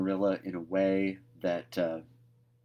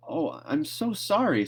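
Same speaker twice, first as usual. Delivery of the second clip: tearful apology; 7 semitones higher than usual. A narrator's reading. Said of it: "tears welling up in, into her eyes".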